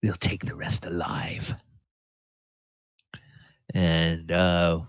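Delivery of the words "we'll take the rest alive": harsh whisper; whispered order. Acted. Glassstaff whispering orders to his bugbear. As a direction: hiss the order under your breath.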